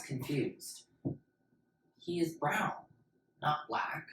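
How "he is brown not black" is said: put-on small child's voice. Confused child correcting.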